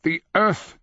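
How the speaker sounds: high, strained grunt of effort; effortful old man's voice. A narrator's reading. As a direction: grunt it out while heaving. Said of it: "he grunted".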